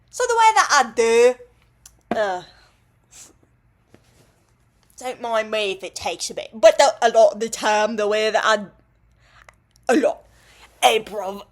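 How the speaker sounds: loud, hammed-up Cockney; weird Cockney accent. Shown as a demonstration. Vlogger demonstrating their accent attempts, loud weird Cockney. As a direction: belt it out in a badly faked Cockney. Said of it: "like, a weird Cockney accent".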